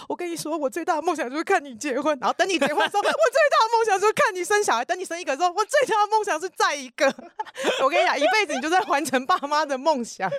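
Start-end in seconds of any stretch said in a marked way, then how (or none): 0.1-2.2 higher, doting parent voice; imitating parents. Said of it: "都说". 3.0-4.8 much higher, pleading parent voice; imitating parents. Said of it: "都说". 5.5-7.1 much higher, eager parent voice; imitating parents. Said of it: "都说".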